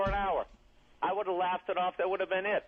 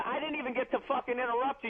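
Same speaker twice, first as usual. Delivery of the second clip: ordinary delivery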